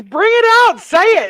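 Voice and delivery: high, loud egging-on; loud goading. Real, not acted. Host daring her friend to say it, loud goading. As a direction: push her to speak up, loudly.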